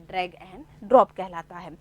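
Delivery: "drag and drop" said slowly, word by word; slow emphasis. Shown as a demonstration. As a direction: dictate the answer "drag and drop" to the class. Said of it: "क्या कहलाता है". (धीमे, रुक-रुक कर उत्तर बताते हुए)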